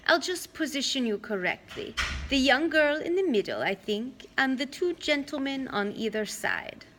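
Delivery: a hint of a fussy old aunt posing them; Auntie Em voice. Old aunt arranging the kids for a photo, fussy.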